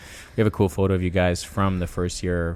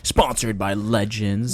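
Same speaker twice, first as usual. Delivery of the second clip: infomercial. Acted. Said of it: "I had a good infomercial voice there".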